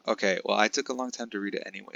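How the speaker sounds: ordinary talk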